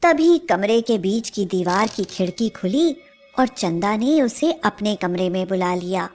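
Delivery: plain reading voice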